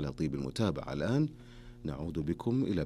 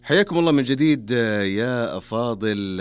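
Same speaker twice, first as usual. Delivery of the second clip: much higher and louder, a bright burst of welcome; excited welcome back; 6 semitones higher, 9 dB louder than usual. Real, not acted. Radio host welcoming listeners back, excited. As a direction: greet the listeners with a big lift in voice.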